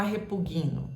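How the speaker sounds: "repugno" dito como "repuguino"; pronúncia errada. Shown as a demonstration. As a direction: solte um I depois do G de "repugno". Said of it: "Nunca você vai falar". ("repugno" errado, soando "repuguino")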